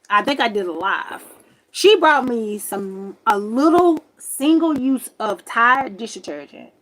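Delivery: normal talking voice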